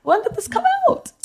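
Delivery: high-pitched, stunned questioning; shocked disbelief. Real, not acted. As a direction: react to bad news in high-pitched disbelief. Shocked by news of show's cancellation.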